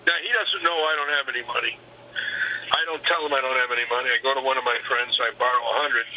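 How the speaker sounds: usual speaking voice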